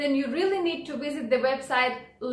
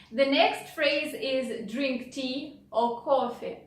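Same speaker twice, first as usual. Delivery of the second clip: normal talking voice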